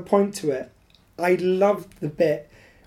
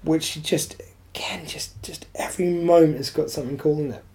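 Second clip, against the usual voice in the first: hushed whisper; 3 semitones lower than usual. Real, not acted. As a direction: whisper it like a secret. Drops to a whisper.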